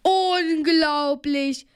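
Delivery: slow, stretched-out exclamation; drawn-out call to a friend; amazed call. A narrator's reading. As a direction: draw the word out in amazement. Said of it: "rief Bob Justus zu".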